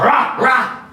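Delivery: two sharp high-pitched barks; dog bark. Acted. Mocking opponents as dogs, barking. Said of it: "our dog bark".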